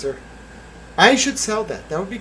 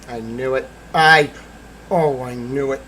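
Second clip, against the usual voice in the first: ordinary delivery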